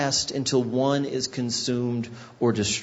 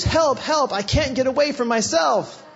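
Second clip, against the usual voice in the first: high, loud mock cry for help; child's hammed-up cry for help, much higher; imitating child; 11 semitones higher, 3 dB louder than usual. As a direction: play the kid running shrieking. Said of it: "She runs through the house and goes".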